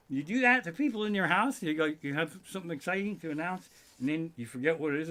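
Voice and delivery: ordinary talk, nothing marked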